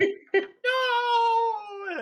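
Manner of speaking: loud high wail as you're blown off. Acted. Ghoul blown away.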